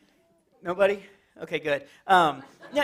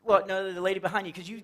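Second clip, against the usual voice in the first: usual speaking voice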